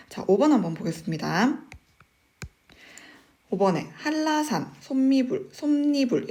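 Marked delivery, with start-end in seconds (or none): 4.0-4.7 한라산 said high as 할라산, with double l; pronunciation demo. Shown as a demonstration. Teacher reading out question five, 한라산 pronounced 할라산. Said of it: "이렇게 발음을 하는데". 4.8-6.3 솜미불 then 솜니불, sounded out distinctly; pronunciation demo. Shown as a demonstration. Teacher reading out question five, sounding out 솜니불. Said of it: "이렇게 발음을 하는데".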